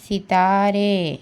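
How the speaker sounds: सितारे said slowly, each syllable drawn out; slow demo. Shown as a demonstration. Teacher sounding out सितारे slowly for her students. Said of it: "इस तरीके से".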